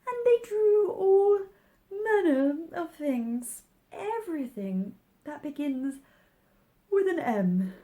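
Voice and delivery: sleepy Dormouse. A narrator's reading. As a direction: yawn through it like a sleepy mouse. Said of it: "for it was getting very sleepy".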